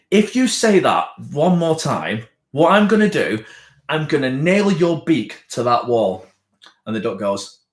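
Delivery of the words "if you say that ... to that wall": slow, raised, emphatic warning; hammed-up exasperated ultimatum; acted angry threat. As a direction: threaten as a fed-up barman, word by word. Joke's barman threatens the duck.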